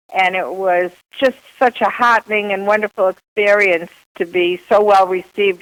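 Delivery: usual speaking voice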